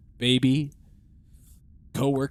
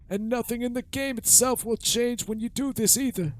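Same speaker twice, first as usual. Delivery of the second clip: put-on high creaky old man; old man voice, pitched high; 7 semitones higher than usual. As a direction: play a rickety old man. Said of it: "I have to do the old man voice".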